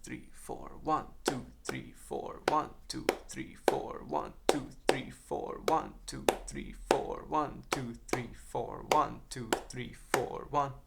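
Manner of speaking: counting the beat steadily under the breath; rhythmic counting. Shown as a demonstration. Drum teacher counts the clave pattern, softly counting. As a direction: keep time by counting the pattern.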